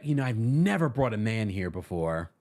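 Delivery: a hint of a put-on low voice; Marlo. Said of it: "and she's like".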